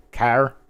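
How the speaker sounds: "Care?" with a broad a, like kʰæɹ; Northern New York care. Shown as a demonstration. Asked again, saying "Care?" upstate style. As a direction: drag "Care?" into a flat upstate a. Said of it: "the people that say care".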